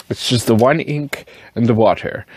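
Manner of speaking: ordinary delivery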